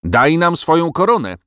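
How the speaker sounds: bold, high-pitched call; called-out demand. A narrator's reading. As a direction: fire the demand back at the king. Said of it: "zawołał Giles".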